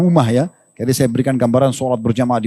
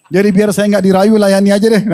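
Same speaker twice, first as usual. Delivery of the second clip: higher, louder, amused wife; wife's voice; 5 semitones higher, 5 dB louder than usual. Retold or imitated. Wife giving in to avoid being wooed, higher and amused. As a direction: play the resigned wife, half laughing.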